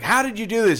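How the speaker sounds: high-pitched mock outrage; imitated yelling. Woman yelling at her old man over the tab, high mock outrage. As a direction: imitate an exasperated scolding. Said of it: "she's yelling at this old man".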